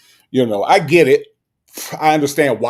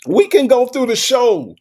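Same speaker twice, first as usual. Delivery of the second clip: exasperated; 12 semitones higher, 7 dB louder than usual. Real, not acted. Heated debate, pitch jumps up.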